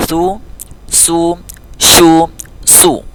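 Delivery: "su, su, shu, su": lisped, hissed, hushed, then rounded; contrasting sibilants with u. Shown as a demonstration. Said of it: "sin dia agak berdesisnya kuat".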